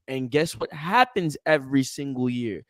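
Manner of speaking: ordinary talk, nothing marked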